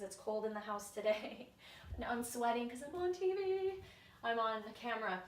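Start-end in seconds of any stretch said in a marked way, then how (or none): 2.0-5.2 playful aside. Real, not acted. Joking aside about sweating on camera.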